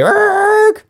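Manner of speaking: a high imitated tyre screech. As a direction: squeal like the getaway car. Car pulling away.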